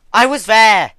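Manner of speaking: grieving sister screaming at her mentor; angry scream. A narrator's reading. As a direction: shriek it out in fury. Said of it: "she screamed angrily".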